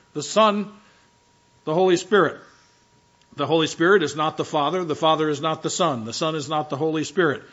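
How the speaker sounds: ordinary talk, nothing marked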